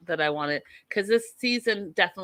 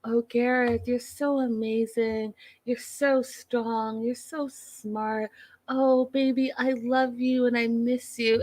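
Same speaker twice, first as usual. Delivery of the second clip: higher, slow and fawning; higher, slow mocking coo of a devoted partner; mock adoring girlfriend; 5 semitones higher, slower than usual. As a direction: gush over Garrett like a doting submissive wife. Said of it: "like, more".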